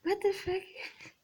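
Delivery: high, slow, disbelieving; high drawn-out disbelief; stunned what the fuck. Real, not acted. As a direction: drag it out in shock. Outraged reaction to message.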